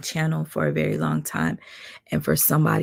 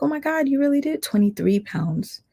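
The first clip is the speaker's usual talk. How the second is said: higher, gushing mock praise; impressed gushing; 10 semitones higher than usual. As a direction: replay her fake amazement. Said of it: "I was like".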